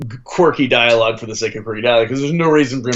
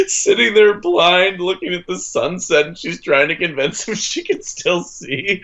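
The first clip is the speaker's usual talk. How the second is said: laughing through the story, higher; giggly retelling, barely holding it; 7 semitones higher than usual. Real, not acted. Laughing while retelling joke.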